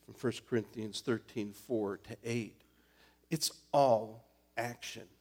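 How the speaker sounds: ordinary delivery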